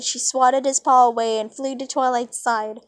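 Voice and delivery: ordinary delivery, nothing marked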